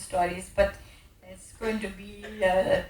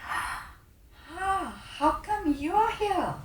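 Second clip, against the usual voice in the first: surprised greeting; 8 semitones higher, 5 dB quieter, much slower than usual. Retold or imitated. Surprised greeting of old relative.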